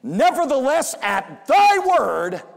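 Nevertheless loud and high, at thy word drawn out slowly; emphasized. Retold or imitated. Preacher voicing Peter telling his partners, emphasized and slow. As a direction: lean hard on Nevertheless then stretch at thy word. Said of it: "he really must have emphasized these next words".

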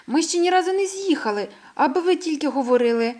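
put-on a young mole complaining, fed up and indignant. A narrator's reading. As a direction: protest that all they do is talk. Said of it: "обурився він".

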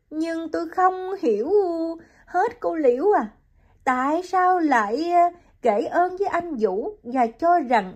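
high woman's voice, puzzled and hurt; Hiền asks Liễu why Ông Thiện boasted, high and bewildered; young woman bewildered. Acted. Hiền confused.

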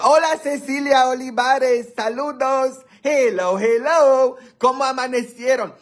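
high, sing-out hello; excited greeting. Real, not acted. Livestream host greeting arriving viewers, excited high call. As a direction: call out a high, lively welcome.

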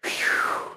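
long unvoiced blown-out breath; whooshing sigh; relieved exhale. Real, not acted. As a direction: puff out air to steady yourself. Steadying self before rejoining.

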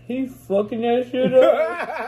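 slow, laughing through the words; cracking up while saying it. Real, not acted. Laughing at a friend.

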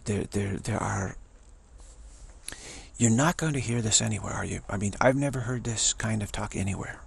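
normal talking voice

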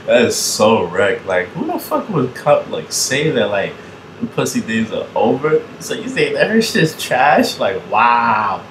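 high, loud incredulous reaction; amused disbelief. Real, not acted. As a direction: exclaim as if you can't believe it. Shocked, amused reaction to the clip.

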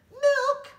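high, loud exclaiming "Milk!". A narrator's reading. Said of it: "they exclaimed".

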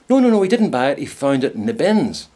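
high, loud insisting "no, no, no"; a lightly voiced friend setting the record straight; eager correction. [name] correcting Rosemary about the hairdryer, high and emphatic.

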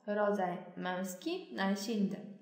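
ordinary talk, nothing marked

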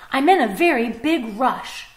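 in too big a rush to stop; hurried fox. Acted.

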